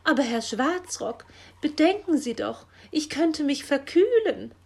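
high, loud whine; wailing complaint. A narrator's reading. Magpie begging Herr Schwarzrock over the cold, wailing complaint. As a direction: bewail it, pleading to be spared. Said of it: "jammerte Frau Elster".

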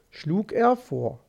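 plain reading voice

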